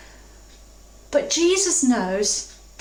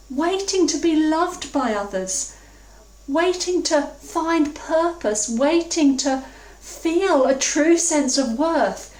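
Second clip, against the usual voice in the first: ordinary talk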